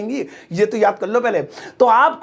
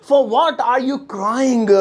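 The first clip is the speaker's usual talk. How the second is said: very slow, word by word; stretched out; slow repeat; 3 semitones higher, much slower than usual. Shown as a demonstration. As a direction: drill it for the class. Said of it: "तुम किस लिए रो रहे हो".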